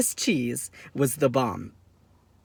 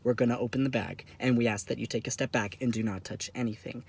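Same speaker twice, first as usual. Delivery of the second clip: low, soft, grave official warning; very serious; 4 semitones lower, 6 dB quieter than usual. Acted. TSA agents warning a traveller before opening his bag, very seriously. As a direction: instruct him sternly without raising the voice. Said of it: "they say very seriously".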